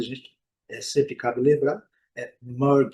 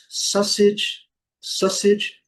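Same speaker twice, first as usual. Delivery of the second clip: sausage in British English, higher pitched; higher British English rendering of sausage; 7 semitones higher, 3 dB louder, much slower than usual. Shown as a demonstration. Teacher contrasts two varieties for learners, British pronunciation. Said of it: "no inglês britânico".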